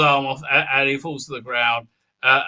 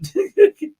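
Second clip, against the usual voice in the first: high short giggle; real giggle at own silliness; 4 dB louder than usual. Real, not acted. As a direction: let a small high titter out.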